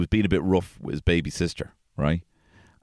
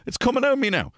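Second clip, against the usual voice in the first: put-on screaming child; 4 dB louder than usual. Son panicking as pee comes out, high loud wail. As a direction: wail like a toddler caught short. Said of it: "He'd be screaming".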